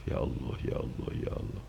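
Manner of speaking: whispered ya Allah in disbelief; awed whisper. Retold or imitated. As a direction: breathe it out, stunned. (bisik takjub)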